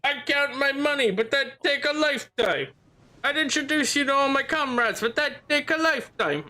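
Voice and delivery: high mock voice parroting the game characters. Mocking the game characters' lines.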